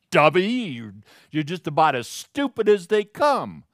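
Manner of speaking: hammed-up scorn; mock insult. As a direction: toss out a playful put-down. Acts out insulting someone.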